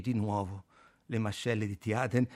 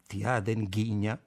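ordinary delivery, nothing marked

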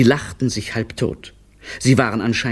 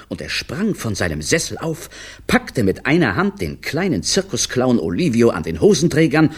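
ordinary delivery, nothing marked

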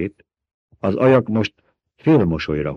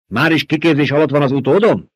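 snapped; 7 semitones higher, 4 dB louder than usual. A narrator's reading. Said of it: "csattant föl Peter".

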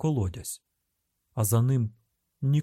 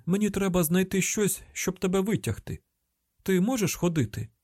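high, insistent voice; raised pitch, pressing question; urgent calling out; 8 semitones higher than usual. A narrator's reading. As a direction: explain urgently to someone down in a pit. Urgent talk to hostage below.

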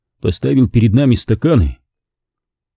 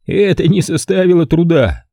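higher, playful host voice; tossed off lightly, teasing; 8 semitones higher than usual. A narrator's reading. Stanton answering his guest, playful and higher. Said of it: "игриво ответил он".

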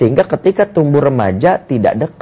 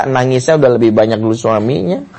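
normal talking voice

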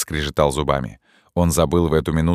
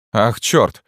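higher, louder startled curse; startled exclamation; 6 semitones higher, 4 dB louder than usual. A narrator's reading. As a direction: blurt it out in shock. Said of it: "воскликнул Феб". (испуганный возглас)

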